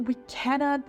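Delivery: normal talking voice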